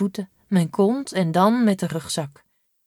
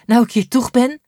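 panting; 5 semitones higher, 6 dB louder than usual. Acted. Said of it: "zegt ze hijgend".

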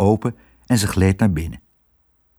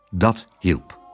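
usual speaking voice

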